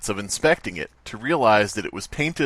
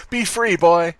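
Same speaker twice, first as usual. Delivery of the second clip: a hammed-up goodbye to a fish; mock farewell to goldfish; 6 semitones higher, slower than usual. Kid tossing goldfish from the van, hammed-up farewell. Releasing goldfish out car window.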